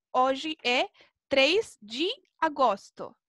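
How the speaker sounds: Portuguese date, "de" said "dji"; lone DE as "dji". Shown as a demonstration. Teacher repeating the example for pupils, "de" pronounced "dji". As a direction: say "Hoy" as OH-zhi, "de" as "dji". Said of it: "Ahí tienen el ejemplo".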